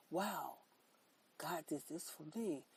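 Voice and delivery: ordinary talk, nothing marked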